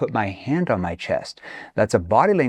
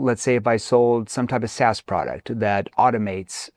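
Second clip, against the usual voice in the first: ordinary talk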